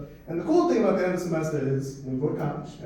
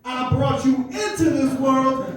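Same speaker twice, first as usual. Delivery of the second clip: parent's threat; 5 semitones higher, 5 dB louder, slower than usual. Retold or imitated. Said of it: "say it with me now".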